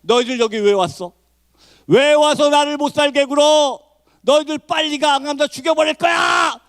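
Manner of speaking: menacing re-enactment building to a high shout; retold possessed shouting. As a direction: start contained, then yell high, stretching the threats. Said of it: "저한테 이렇게 소리 지르더라". (귀신 들린 듯 악쓰는 재연)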